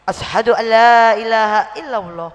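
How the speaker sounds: loud, ringing recitation; re-enacted loud declaration of faith; declaring the shahada. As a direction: proclaim it loudly, as a solemn testimony.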